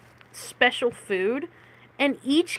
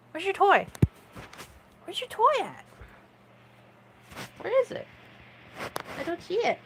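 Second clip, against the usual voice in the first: high, soft sing-song to a cat; playful pet talk; 10 semitones higher, 8 dB quieter than usual. Real, not acted. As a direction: ask the kitty up high and softly. Hunting toy with the cat.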